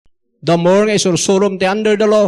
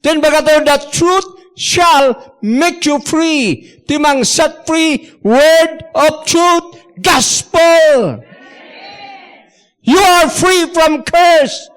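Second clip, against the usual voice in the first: full-force preaching; 8 semitones higher, slower than usual. Real, not acted. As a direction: drive each phrase home to the church.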